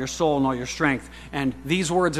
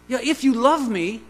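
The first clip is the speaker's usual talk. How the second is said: higher, louder, earnest Jesus voice; preacher voicing Jesus, higher and louder; 8 semitones higher, 6 dB louder than usual. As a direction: speak as Jesus, pleading and bright. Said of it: "He says".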